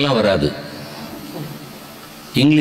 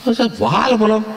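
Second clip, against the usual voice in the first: lightly voiced Tamil villager, quick and higher; a hint of a simple local man offering a banana; Tamil man answering; 8 semitones higher, much faster than usual. Teacher playing a Tamil man speaking to an Englishman, quick and higher.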